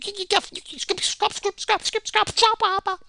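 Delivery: high babbling caricature; mocking Nurmagomedov stammer. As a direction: play him tripping over the words comically. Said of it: "Tipped his hat and said".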